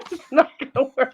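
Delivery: high hiccupping giggle fit; giggling, can't stop; hiccup giggles. Real, not acted. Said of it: "I can't stop laughing".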